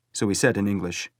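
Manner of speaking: ordinary delivery, nothing marked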